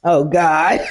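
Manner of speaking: usual speaking voice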